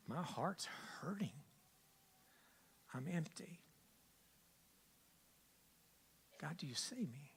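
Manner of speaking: soft, near-whispered plea to God; broken quiet prayer; hushed honest prayer. Retold or imitated. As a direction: confess the struggle quietly. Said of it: "I'm gonna be honest with you".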